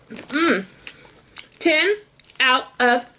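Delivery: speaking between bites; talking while chewing. Real, not acted. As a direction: mouth partly full of snack. Seen 1: snack eaten at -2.0, chewing closed-mouth, another piece at 3.1.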